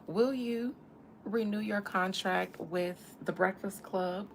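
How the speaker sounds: ordinary delivery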